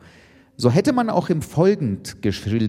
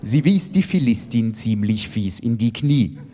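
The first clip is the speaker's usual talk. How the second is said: ordinary delivery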